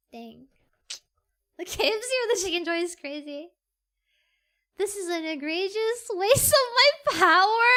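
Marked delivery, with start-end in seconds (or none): 4.8-7.8 mock outrage. Playful outraged line, laughing.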